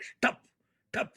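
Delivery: two short, punchy gunshot pops; sudden percussive vocal bangs; imitated gunshots. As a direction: fire two separate shots with the voice. Imitates two gunshots in retold scene.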